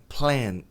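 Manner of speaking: PLAN with long cat vowel; PLAN, open E-like A; correct pronunciation of plan. Shown as a demonstration. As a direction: say "plan" as "plaen". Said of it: "Plan e não plan".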